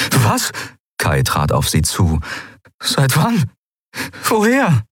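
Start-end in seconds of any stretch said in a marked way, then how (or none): 0.1-0.5 sudden higher-pitched question; taken aback. A narrator's reading. Kai hearing that Ailan knows, startled. 2.8-4.8 high, startled demands; urgent questioning. A narrator's reading. Kai questioning Ailan about what she knows, urgent.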